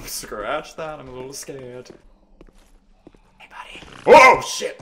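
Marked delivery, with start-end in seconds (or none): 3.4-3.9 very soft whispered greeting; stealthy whisper. Real, not acted. Player sneaking up on an enemy, stealthy whisper. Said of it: "trying to be stealthy". 4.1-4.8 sudden high, loud outburst; startled yell. Real, not acted. Player caught while sneaking up, startled yell.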